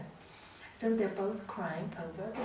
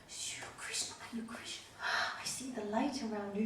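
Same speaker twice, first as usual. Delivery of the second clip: awed whisper; 6 dB quieter than usual. Retold or imitated. Old man awed by Krishna.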